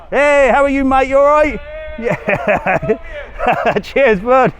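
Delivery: loud, high friendly greeting with laughs; calling out a greeting. Real, not acted. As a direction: shout a happy hello to a mate across the street.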